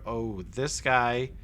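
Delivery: usual speaking voice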